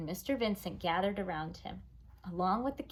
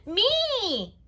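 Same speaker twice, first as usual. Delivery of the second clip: put-on much higher, loud little-kid demand; demanding toddler; 14 semitones higher, 8 dB louder than usual. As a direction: insist like a toddler.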